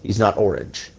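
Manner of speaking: ordinary talk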